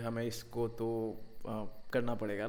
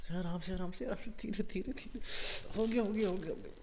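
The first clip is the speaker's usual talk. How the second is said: mock soothing; 9 semitones higher than usual. Torturer mock-soothing screaming victim.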